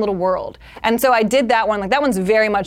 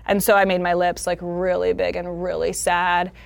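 ordinary delivery

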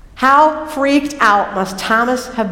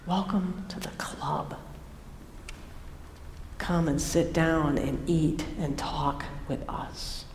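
very soft, slow, lower invitation; hushed welcome; 6 semitones lower, 15 dB quieter than usual. Acted. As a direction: gently take someone in, almost whispering. Welcoming a struggling doubter in.

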